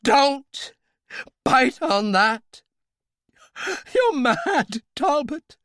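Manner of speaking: high, loud panicked shouting; terrified screaming. Acted. Royale screaming at Talbot in high loud terror. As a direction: scream in horror at him.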